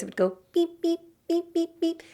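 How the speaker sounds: high electronic beeps; imitated beeps. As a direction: chirp it like a little gadget. Imitating the device's beeping.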